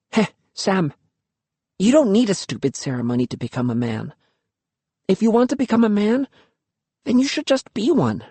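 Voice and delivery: quick and high, rushing the idea; eager idea. Acted. Luki pitching her bold plan, quick and eager. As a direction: sell him the plan.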